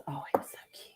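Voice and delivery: soft whispered gush; hushed cooing. Real, not acted. Co-host gushing over the little lizard, hushed cooing. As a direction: whisper it, low and adoring.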